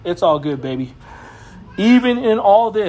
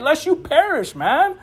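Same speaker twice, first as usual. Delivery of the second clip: normal talking voice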